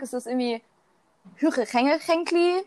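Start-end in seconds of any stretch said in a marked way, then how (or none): 1.4-2.6 slow, higher-pitched mock Swiss German; Swiss German accent. Shown as a demonstration. Mocking the Swiss word for kitchen cupboard, Swiss German accent. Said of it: "schweizerisch Halsmaul".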